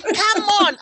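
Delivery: high, loud coaxing call; egging a lazy friend on, loud and high; mock coaxing colleague. Friend urges him to work: high loud coaxing. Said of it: "he's saying".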